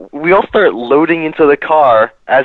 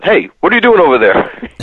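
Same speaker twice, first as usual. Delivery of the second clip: security guard call; 5 semitones higher than usual. Retold or imitated. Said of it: "the security guard said".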